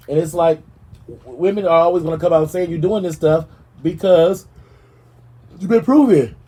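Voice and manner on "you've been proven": high, loud burst on proven; outburst. Real, not acted. Scolding [name] over his record, loud and high.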